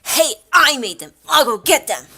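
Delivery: loud, high, indignant outburst; indignant game girl raising her voice; acted indignation. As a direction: butt in loudly to claim the credit. Voicing game girl's indignant objection.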